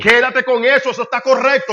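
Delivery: loud, high preaching voice; forceful exhortation. Real, not acted. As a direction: urge the congregation at full force.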